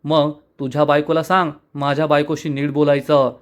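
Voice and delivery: usual speaking voice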